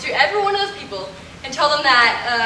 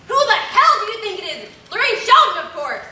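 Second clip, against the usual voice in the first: indignant outburst; 6 semitones higher than usual. Acted. Indignant actress snapping at the operator.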